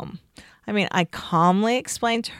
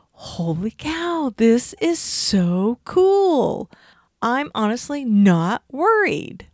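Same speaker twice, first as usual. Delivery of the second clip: slow, higher, wide-eyed wonder; amazed realization; 4 semitones higher, slower than usual. Retold or imitated. Woman realizing she feels no worry, slow awed wonder. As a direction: savor the discovery slowly, awed. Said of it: "it made me stop and think".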